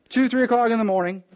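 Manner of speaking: ordinary talk, nothing marked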